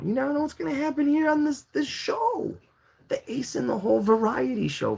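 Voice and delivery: very high, squealing show hype; mock-amazed hype. Host hyping the wild variety show, in high mock amazement.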